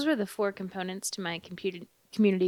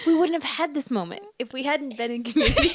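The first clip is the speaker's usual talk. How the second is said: higher giggly delivery; amused laughing remark; 7 semitones higher, 4 dB louder than usual. Real, not acted. Laughing at the coincidence.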